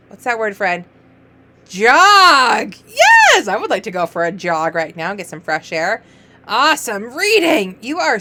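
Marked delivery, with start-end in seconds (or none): 1.7-3.5 loud, rising triumphant cheer; excited shout. Shown as a demonstration. Teacher celebrating a reader's word, excited shout. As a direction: shout the answer with delight.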